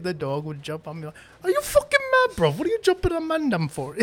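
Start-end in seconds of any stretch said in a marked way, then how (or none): 1.4-3.9 high, loud cracking London gangster voice; Top Boy accent. Acted. Friend scolding the dog as a joke, high London accent. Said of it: "when I do that accent".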